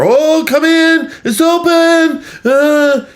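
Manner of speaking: very high, slow, loud fake-sweet call; hammed-up fake-cheerful "come in" while faking CPR; mock sing-song welcome. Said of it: "then went to fake doing C P R".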